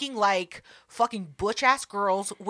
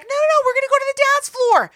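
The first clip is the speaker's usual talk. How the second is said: much higher, fast, gushing party friend voice; excited friends; 14 semitones higher, 5 dB louder, faster than usual. Retold or imitated. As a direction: rush it like a giddy friend dragging her along. Voicing her excited friends.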